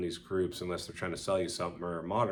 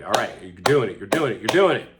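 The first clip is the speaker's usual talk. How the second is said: self cheer; 8 dB louder than usual. Retold or imitated. Cheering himself on during exercise.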